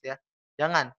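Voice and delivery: normal talking voice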